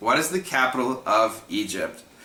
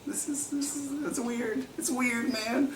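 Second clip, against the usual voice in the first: tiny, very high, soft voice; small high-pitched mock dismay; weirded out; 13 semitones higher, 8 dB quieter than usual. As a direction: squeak it softly, weirded out. Reacting to kids' flat-phone mime.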